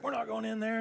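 whiny crowd. Retold or imitated. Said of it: "They're like".

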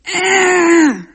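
put-on high, loud drawn-out baby scream; imitated baby shriek. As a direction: screech like a defiant baby. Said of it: "she went".

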